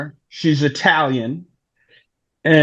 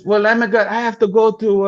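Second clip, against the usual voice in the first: higher, light woman's voice; Italian woman voice; 6 semitones higher, faster than usual. Retold or imitated. Italian woman asks where his car is, in a higher voice. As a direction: voice her lightly and airily. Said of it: "She says".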